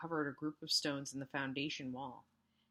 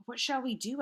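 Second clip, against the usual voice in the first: frightened; 8 semitones higher, 6 dB louder than usual. A narrator's reading. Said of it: "asked Bess".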